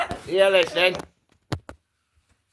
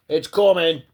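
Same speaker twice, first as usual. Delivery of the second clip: playful louder warning; louder teasing warning; 5 semitones higher, 6 dB louder than usual. Pretend. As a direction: build up the threat. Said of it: "Now it's coming".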